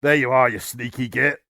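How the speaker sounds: restrained orc roar. A narrator's reading. Orc boss spotting the Chaplain, roaring. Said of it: "Gregarious roared".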